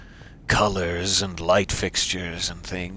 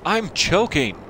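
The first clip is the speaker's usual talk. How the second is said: mock choking cry; 9 semitones higher, 5 dB louder, slower than usual.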